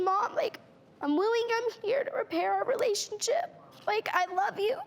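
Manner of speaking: high, earnest plea to her mother; pleading with mom. Retold or imitated. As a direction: beg her mother to get help, voice lifted high. Retold plea to mother, raised voice.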